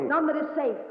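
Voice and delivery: high, loud, cutting reply; firm insistence. Acted. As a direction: shut down the protest. Sister insists coldly.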